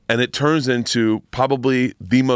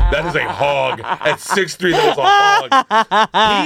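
higher and louder, drawn out through laughter; laughing amazement; 7 semitones higher, 3 dB louder, much slower than usual. Retold or imitated. To a friend about a porn star, laughing disbelief. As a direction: stretch out that is a hog while laughing. Reacting to his height.

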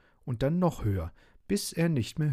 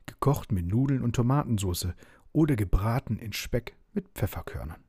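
usual speaking voice

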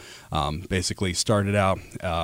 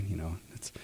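hushed aside; whispered aside; 12 dB quieter than usual. Real, not acted. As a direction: breathe it under your voice. Sudden aside under breath.

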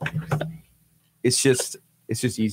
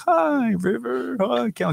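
high, drawn-out mock hello; imitating an overfriendly adult greeting kids; gushing greeting; 9 semitones higher, 4 dB quieter, much slower than usual. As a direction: coo it at a little child, exaggerated. Imitating his gushing greeting to children.

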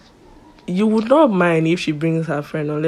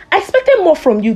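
imitating the lecturer; 10 semitones higher than usual. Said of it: "call Fikemi and be like".

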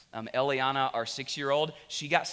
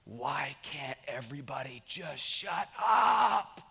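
retold scream; slower than usual. Father snapping at his whole family, reenacted scream. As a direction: build to an exasperated yell on the last word. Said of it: "I screamed at the top of my voice".

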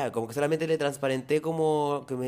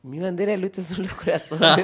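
mock dramatic laughing; 3 semitones higher, 7 dB louder than usual.

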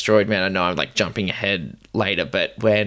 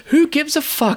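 imitating Paige, much higher and louder; Paige's outburst; 3 dB louder than usual. Paige dismissing Kyle's late work, high scornful outburst. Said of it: "she was like".

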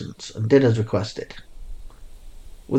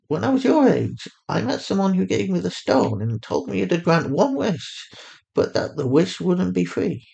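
higher mother voice, calm and steady; Fatima, soothing mother; 8 semitones higher than usual. A narrator's reading. A mother on the phone calmly reassuring her lost daughter.

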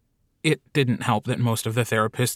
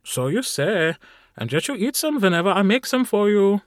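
teasing Carl; 9 semitones higher than usual. Acted. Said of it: "Carl snorted".

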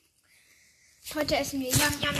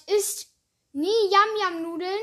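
higher, louder warning to viewers; 5 semitones higher, 5 dB louder, slower than usual. Real, not acted. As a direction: warn the viewers emphatically. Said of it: "Ich sag euch".

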